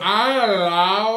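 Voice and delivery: put-on slow, loud drunk girl voice; basic girl voice. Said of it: "Every, every basic girl voice".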